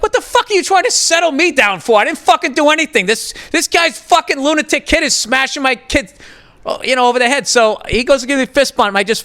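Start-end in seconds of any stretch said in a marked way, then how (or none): 0.0-7.5 higher, heated retelling of his rant; retold angry outburst. Dad retelling how he snapped at the other parent, higher and heated. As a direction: fire back at the other dad, riled up.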